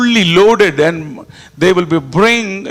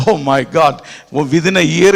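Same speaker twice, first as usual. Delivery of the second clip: normal talking voice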